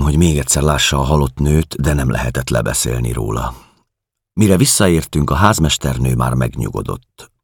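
plain reading voice

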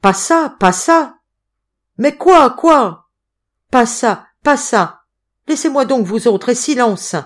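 loud, high cries of protest; panicked shouting. A narrator's reading. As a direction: shout down the room in alarm. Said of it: "cria-t-il". (cris affolés)

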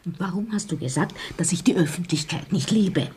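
whispering. Acted. Wife whispers to husband at event.

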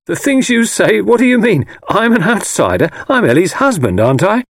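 high, loud, affronted outburst; indignant protest. A narrator's reading. Husband rejecting being called an outsider, indignantly. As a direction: bristle at the accusation, pitch shooting up.

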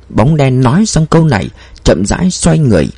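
plain reading voice

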